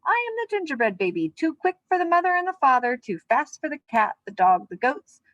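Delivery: put-on boastful gingerbread baby. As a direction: crow it out like a smug little cookie.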